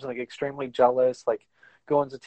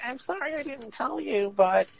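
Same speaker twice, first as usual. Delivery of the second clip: very high, put-on girlish apology; mock anime girl; 12 semitones higher than usual. Anime girl confessing to the guy, high mock apology. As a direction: plead sorry in a squeaky parody voice.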